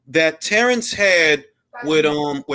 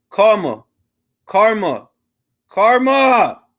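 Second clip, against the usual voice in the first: building louder calls of a name; calling out; 5 semitones higher, 5 dB louder, slower than usual. Real, not acted. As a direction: call him, louder and higher each time. Calling out to Karma.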